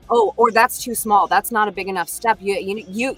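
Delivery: ordinary talk, nothing marked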